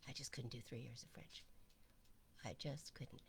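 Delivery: whispered confession, soft and breathless; whispered aside. Real, not acted. Confessing a failure to the group, in a whisper. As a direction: let it slip out as a whisper.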